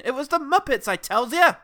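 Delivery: high, loud cartoon plea; desperate defendant. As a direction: protest it like a hammy old-time crook. Driver pleading in court.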